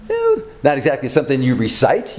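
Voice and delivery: normal talking voice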